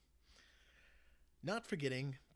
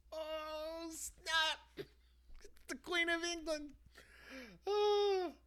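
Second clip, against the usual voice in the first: much higher excited gasp of delight; excited surprise; 5 dB louder, much slower than usual. Real, not acted. Reader reacting to the Queen appearing, thrilled surprise. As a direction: react like the twist just floored you.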